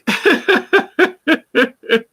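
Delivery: high giggling laugh; a real high laugh, trailing out. Real, not acted. Host laughing at her own lawyer joke, high giggle.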